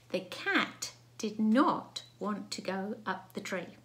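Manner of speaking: slow, word-by-word dictation; dictated sentence. Shown as a demonstration. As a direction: read it out slowly, spacing the words. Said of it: "Now, the sentence today is".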